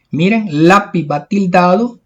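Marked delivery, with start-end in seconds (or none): none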